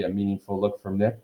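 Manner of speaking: ordinary delivery, nothing marked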